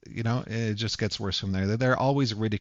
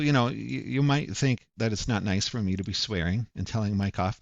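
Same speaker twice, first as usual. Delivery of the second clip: ordinary delivery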